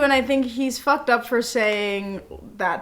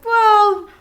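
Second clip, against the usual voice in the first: mock hesitant well; 9 semitones higher, 9 dB louder than usual. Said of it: "I was like".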